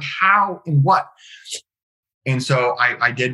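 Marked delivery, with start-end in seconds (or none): 0.0-1.1 high-pitched, incredulous retelling; exasperated questioning. Retold or imitated. Questioning his own business purpose, exasperated. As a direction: act out being baffled, pitched well up.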